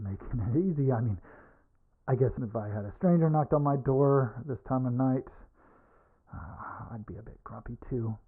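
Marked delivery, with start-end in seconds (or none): none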